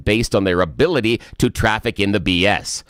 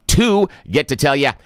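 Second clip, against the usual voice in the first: usual speaking voice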